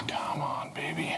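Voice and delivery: very soft, near-whispered urging; hushed coaxing. Real, not acted. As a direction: coax the part under your breath. Coaxing the stuck spacer into place.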